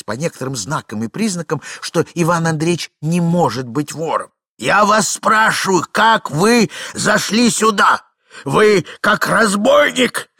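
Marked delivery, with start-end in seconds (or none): 4.6-10.3 высокий протяжный окрик; требуй ответа, чеканя и растягивая слова; гневный допрос. A narrator's reading. Старик гневно допрашивает. (возмущённый старик)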